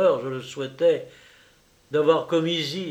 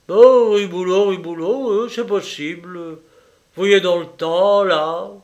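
offhand; 5 semitones higher, 8 dB louder than usual. Acted. Officer vaguely recalls the name.

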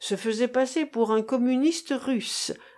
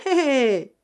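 high cackling hé hé; old man's chuckle; 4 semitones higher, 5 dB louder than usual. Acted. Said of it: "gloussa le vieillard".

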